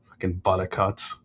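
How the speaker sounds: low muttered curse; muttered under breath. Real, not acted. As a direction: grumble it quietly to yourself. Grumbling at a chatter.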